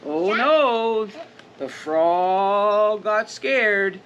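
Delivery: usual speaking voice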